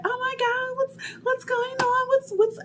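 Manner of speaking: very high, squeaky fake-worried voice; mock clueless friend. Friend arriving late, mocked in a squeaky voice. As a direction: play the ditzy friend, way up high. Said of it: "she was like".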